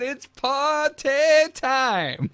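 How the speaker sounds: slow, high, lightly voiced party line; a hint of the wizard's sing-song; imitating Medivh. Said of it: "Did you hear how he said that?".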